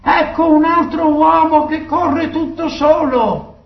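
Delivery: high-pitched sentinel's call. A narrator's reading. Sentinel alerts the guardian of another runner, calling out. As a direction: cry out news from the lookout. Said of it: "gridò al guardiano".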